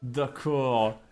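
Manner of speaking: playful put-on southern French accent. Shown as a demonstration. Streamer promising lessons to chat in a southern French accent. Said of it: "uniquement avec l'accent du sud maintenant".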